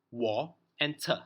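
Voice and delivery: "wa and te", each syllable on its own; split into syllables. Shown as a demonstration. Teacher showing two sounds in water, split into syllables. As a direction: sound out "wa" and "te" separately. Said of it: "two sounds when you pronounce it".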